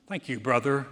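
a touch of lofty condescension; mild imitation of a patronizing thank-you; condescending. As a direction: brush him off, demeaning. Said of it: "in the most condescending, demeaning tone".

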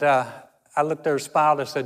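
ordinary talk

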